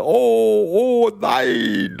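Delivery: high howling oh, then falling; giant's shout of pain. Acted. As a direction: yelp and groan after the hit. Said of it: "sprang und schrie herum".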